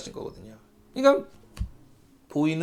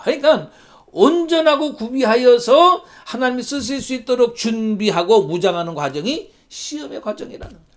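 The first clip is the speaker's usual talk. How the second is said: ordinary talk, nothing marked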